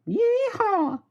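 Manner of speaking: high-pitched cowboy yeehaw; American cowboy whoop. Pretend. Streamer hamming up being American to chat, high cowboy whoop. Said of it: "I'm American as can be".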